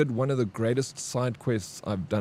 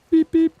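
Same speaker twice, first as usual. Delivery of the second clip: high, loud beeping like a phone alarm; phone beep imitation; 9 dB louder than usual. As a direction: chirp it out like a reminder alarm.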